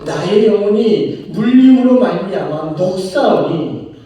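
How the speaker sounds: slow, solemn reading of the verse; preacher reading the psalmist's grief to the congregation, slow and solemn; solemn scripture reading. Acted. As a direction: read the verse like a lament. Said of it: "이십팔 절에서는 이렇습니다".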